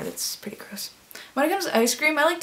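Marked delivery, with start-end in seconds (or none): 0.0-0.9 whisper. Real, not acted. Aside judging herself.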